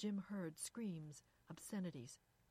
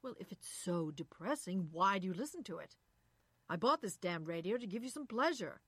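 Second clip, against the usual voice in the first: mildly exasperated husband, emphatic; louder in bursts, reproachful; 6 dB louder than usual. A narrator's reading. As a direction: argue back with some irritation. Husband in acted quarrel.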